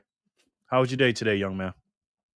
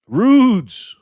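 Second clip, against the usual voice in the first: excited cheer; 7 semitones higher, 9 dB louder than usual. Real, not acted. Cheering a viewer's win.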